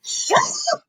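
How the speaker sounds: squeaky, very high imitation scream; mock hysterical screaming. Fan imitates Bianca answering Liv, screaming hysterically. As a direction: let the pretend shriek climb until it cracks away. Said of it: "Screaming, hysterical".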